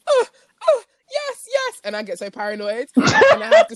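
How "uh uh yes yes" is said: high fake moans of pleasure; mock moaning. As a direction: ham up a porn moan. Mimics porn moaning.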